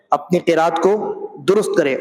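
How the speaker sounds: usual speaking voice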